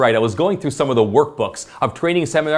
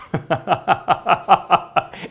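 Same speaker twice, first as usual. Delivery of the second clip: rapid run of chuckles, higher in pitch; 5 semitones higher than usual. Retold or imitated. Salesperson sharing a favorite phrase with a colleague, chuckling. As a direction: let out a long rippling chuckle. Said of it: "I can hear him chuckle".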